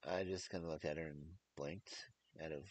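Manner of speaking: ordinary delivery, nothing marked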